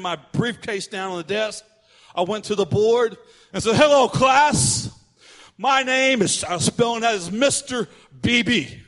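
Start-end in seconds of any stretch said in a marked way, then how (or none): 3.8-6.4 loud, higher, drawn-out classroom greeting; hammed-up teacher's hello; mock teacher. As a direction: announce yourself grandly to the whole room. Plays substitute greeting the class.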